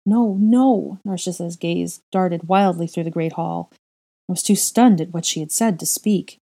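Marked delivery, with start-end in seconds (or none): 0.1-1.0 higher, louder denial; frantic no. Acted. Wife refusing her husband's doubt, frantic. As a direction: refuse it in panic.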